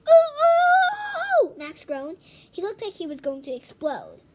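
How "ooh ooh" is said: loud, high, eager ooh ooh; desperate to be called on. Acted. Boy straining to be called on, loud high ooh.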